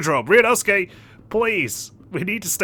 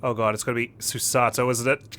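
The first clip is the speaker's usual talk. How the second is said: ordinary talk, nothing marked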